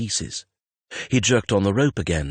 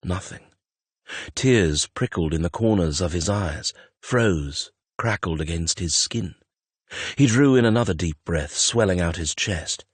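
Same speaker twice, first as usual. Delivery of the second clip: ordinary delivery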